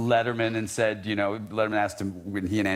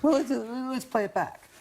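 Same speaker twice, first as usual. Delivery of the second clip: much higher put-on producer voice; lightly hammed-up studio engineer; imitating the producers; 14 semitones higher than usual. Retelling the studio staff with a high put-on voice. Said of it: "and they're like".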